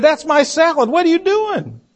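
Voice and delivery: imitated high-pitched shriek at normal volume; mock shriek. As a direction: play the outraged wife, voice shooting way up. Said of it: "And she shrieked".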